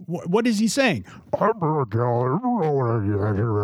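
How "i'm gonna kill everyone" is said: slow menacing movie villain. Retold or imitated. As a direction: drawl it out like a brute.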